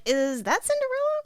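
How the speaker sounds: high, incredulous rising question; mock-puzzled high voice; puzzled dad. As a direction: squeak the question upward as a baffled father.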